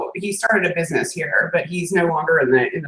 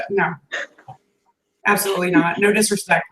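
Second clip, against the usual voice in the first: ordinary talk, nothing marked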